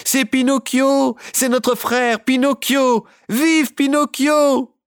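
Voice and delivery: high, loud, slow cheer building to Vive; rally the whole crowd. A narrator's reading. Puppets cheer their wooden brother, high and loud.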